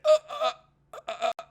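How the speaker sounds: high, halting mock-panicked stammer; flustered stammer. Boy spotted by a girl after killing the cat, flustered stammer. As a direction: stammer like someone caught out, pitched high.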